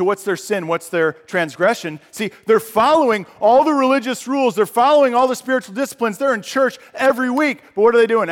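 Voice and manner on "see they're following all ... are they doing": loud, higher preaching push; fiery sermon peak; preaching at full force. Real, not acted. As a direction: drive the point home to the congregation. Preaching builds up.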